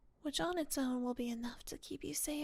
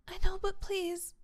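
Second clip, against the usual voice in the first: pleading; 4 semitones higher, 7 dB louder than usual. Acted. Girlfriend begging partner.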